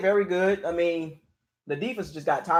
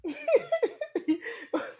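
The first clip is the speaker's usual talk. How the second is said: high laugh; 5 dB quieter than usual. Real, not acted. Rueful laugh at bad stats.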